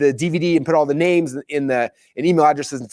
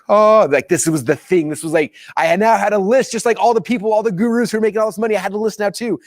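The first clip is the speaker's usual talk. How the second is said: higher-pitched, thrilled recounting; excited; 9 semitones higher than usual. Retold or imitated. Telling how the long-awaited list arrived, excited. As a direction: relive the thrill of it finally arriving. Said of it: "I was so excited".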